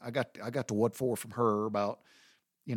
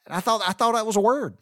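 normal talking voice

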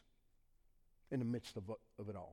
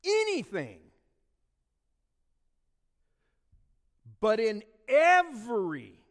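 "anything" higher and louder, "every" slow and loud; stress on anything; 5 semitones higher, 7 dB louder than usual. Shown as a demonstration. Preacher stressing the emphatic words for the church, loud. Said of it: "look at the emphatic word".

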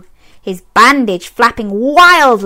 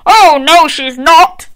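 loud higher yell; knight yelling; 6 semitones higher, 5 dB louder than usual. A narrator's reading. Knight challenging Zog, loud yell. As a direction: shout him down. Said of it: "yelled Gadabout".

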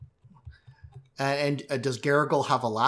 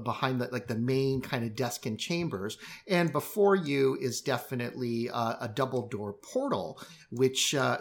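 ordinary talk

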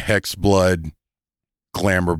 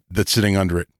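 ordinary talk, nothing marked

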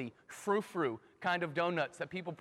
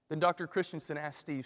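ordinary delivery

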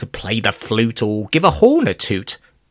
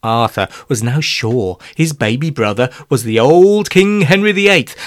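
ordinary delivery, nothing marked

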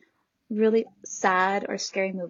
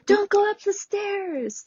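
horror-movie viewer; 9 semitones higher, slower than usual. Retold or imitated. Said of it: "Especially in horror movies".

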